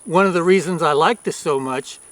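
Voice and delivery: normal talking voice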